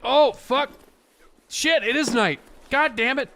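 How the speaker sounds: high, loud real alarm; alarmed cursing. Real, not acted. Gamer realising it is night, real alarmed cursing. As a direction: swear loudly in sudden dismay.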